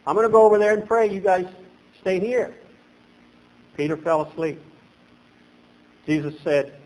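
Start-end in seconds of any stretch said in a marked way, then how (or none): none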